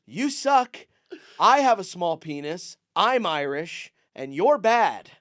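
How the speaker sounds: mock the comic like a drunk fairgoer; mock heckler rant. Voicing the heckler's insults.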